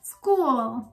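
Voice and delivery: स्कूल with an American accent, no added i. Shown as a demonstration. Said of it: "American लोगों की accent".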